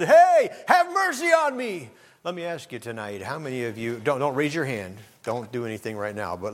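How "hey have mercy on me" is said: very high, loud, slow shout; calling out. Retold or imitated. Blind man shouting to Jesus over the crowd. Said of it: "he calls out and said".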